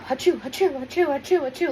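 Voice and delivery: imitated sneezes. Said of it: "I was, like, nonstop".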